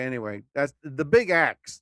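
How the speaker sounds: usual speaking voice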